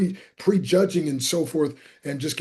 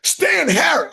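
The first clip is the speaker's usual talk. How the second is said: high, loud excited repeat of the name; loud delighted echo; 11 semitones higher, 6 dB louder, slower than usual. Retold or imitated. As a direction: boom the name back with joy. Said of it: "this is how loud he is".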